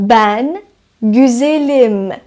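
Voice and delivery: güzelim drawn out very slowly, each syllable clear; slow model sentence. Shown as a demonstration. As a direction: say güzelim slowly as a teacher would. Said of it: "مثلاً". (güzelim را خیلی آهسته و شمرده بگو)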